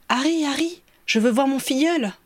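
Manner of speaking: put-on high, eager little boy; child's excited cry. A narrator's reading. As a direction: call out like an impatient child. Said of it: "cria-t-il".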